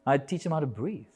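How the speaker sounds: usual speaking voice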